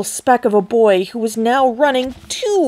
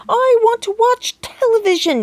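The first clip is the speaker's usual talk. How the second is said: much higher whiny squeal; tantrum squeak; 12 semitones higher than usual. A narrator's reading. Tiny boy throwing a tantrum at his father, squeaky whine. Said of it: "he squeaked".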